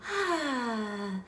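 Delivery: high, soft, falling "ah"; contented sigh. A narrator's reading. Goldilocks sinks into the smallest bed, contented sigh. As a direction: let out a long pleased sigh.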